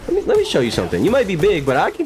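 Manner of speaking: high squeaky trash talk; mocking taunt. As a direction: taunt the big guy in a high voice. Taunting a big enemy in-game.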